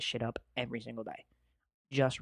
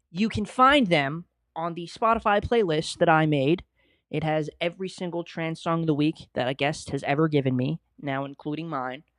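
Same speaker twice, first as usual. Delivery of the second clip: ordinary talk, nothing marked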